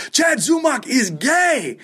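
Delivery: high, drawn-out yell as the club owner; imitated yell. Club owner greeting Chad's friend at the door, imitated manic yell.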